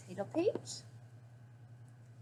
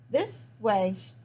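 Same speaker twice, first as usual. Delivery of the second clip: ordinary delivery, nothing marked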